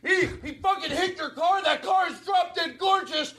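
shouted fake rage. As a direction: yell the overreacting threat to scare the driver. Staged fake rage bit.

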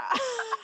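high, shaky laugh on the edge of crying; teary laugh. Real, not acted. Host overcome by friend's childhood story, teary laugh. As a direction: laugh it off as the tears come. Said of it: "I'm, like, getting emotional".

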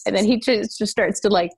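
ordinary talk, nothing marked

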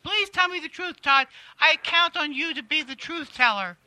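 Kathy Griffin. Acted. Comedienne begging Todd for the truth, in a pleading impression.

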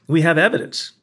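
"have" lifted to counter a denial; stress on HAVE. Retold or imitated. Rebuttal to jury stressing real evidence.

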